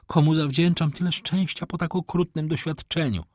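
high lady's voice, mildly elated; overjoyed mother. A narrator's reading. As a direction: wonder in a high voice, relieved. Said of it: "o mało nie oszalała z radości". (uradowana matka, wysokim głosem)

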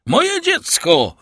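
very high, loud, drawn-out cry; anguished scream. Acted. Villefort finding his child taken, anguished scream. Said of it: "wykrzyknął Villefort".

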